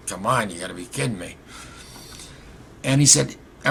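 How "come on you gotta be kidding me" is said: muttered disbelief. Retold or imitated. Unspoken disbelief, not said aloud.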